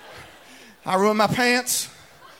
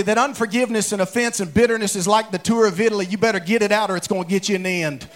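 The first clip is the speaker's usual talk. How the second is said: usual speaking voice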